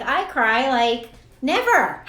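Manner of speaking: NEVER stretched out slow; proud own quote. Retold or imitated. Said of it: "I'm like".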